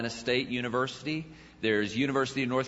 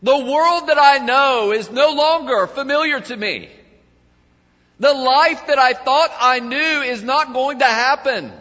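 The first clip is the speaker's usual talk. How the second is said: prophet's lament; 10 semitones higher, 8 dB louder than usual. Acted. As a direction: voice the prophet's despair at full force. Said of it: "The prophet looked around and he said".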